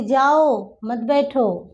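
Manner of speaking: slow, stretched जाओ, then मत बैठो; pause after जाओ. Shown as a demonstration. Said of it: "वक्ता क्या कह रहा है".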